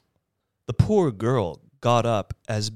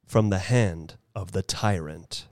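ordinary delivery

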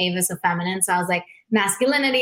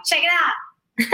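put-on eager teen hustler; 9 semitones higher, much slower than usual. Teen handing out CDs.

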